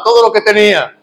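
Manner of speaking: ordinary talk, nothing marked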